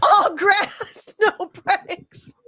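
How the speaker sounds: high, loud through laughter; cracking up. Real, not acted. Co-host repeating the grass joke, high and laughing.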